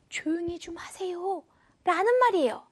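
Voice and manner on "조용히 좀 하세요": softer, gentle appeal; soft polite request. Shown as a demonstration. Teacher translating the polite request, soft and gentle. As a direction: ask nicely, keeping the volume down. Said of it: "부드러운 뉘앙스를 주죠".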